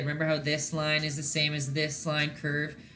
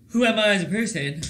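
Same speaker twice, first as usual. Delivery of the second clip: talking through a yawn; words stretched mid-yawn; 4 semitones higher, 7 dB louder, faster than usual. Real, not acted. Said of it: "As I yawn".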